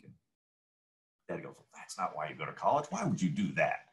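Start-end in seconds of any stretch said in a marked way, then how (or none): 1.6-3.9 low, soft fatherly dismissal; a hint of a disapproving dad, kept low; lightly voiced dad. Dad answers his son about college, mildly disapproving. Retold dad's reply to son.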